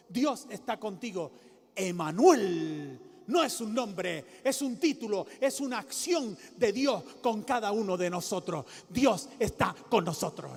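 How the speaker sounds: loud, rising sermon delivery; fiery preaching. Acted. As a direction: preach it out to the whole church. Preaching at full force.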